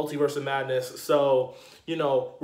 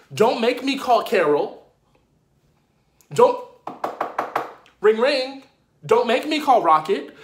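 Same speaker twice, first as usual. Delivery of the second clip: loud, high, incredulous trash talk; mock-threatening rant; 7 semitones higher, 5 dB louder than usual. Fan warning off an upstart wannabe hero, mock outrage. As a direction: threaten and ridicule at raised pitch.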